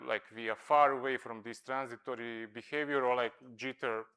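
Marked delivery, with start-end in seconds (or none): none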